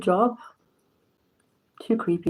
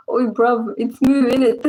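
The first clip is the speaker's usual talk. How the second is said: put-on British cow accent; 3 semitones higher, 3 dB louder than usual. British cow in a silly meme, mock British slang accent. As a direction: play a cow with a cheeky British street accent. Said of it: "four British cows like".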